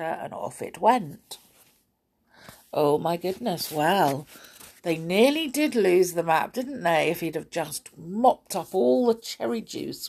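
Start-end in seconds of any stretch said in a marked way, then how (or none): none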